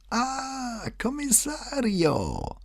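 put-on high, drawn-out greeting from an old man; old notary's high cry of joy; delighted old man. A narrator's reading. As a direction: greet a visitor in a high, joyful old man's voice. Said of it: "mit allen Anzeichen der Freude".

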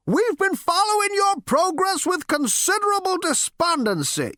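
put-on very high, querulous elderly lecture; lecturing old great-grandfather. Ancestor lectures Zaphod on his progress, high and querulous. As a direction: talk over him in a high old voice.

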